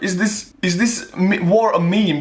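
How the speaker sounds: baffled, high-pitched disbelief; incredulous. Real, not acted. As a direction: react as if it must be a joke. Incredulous reaction to the name.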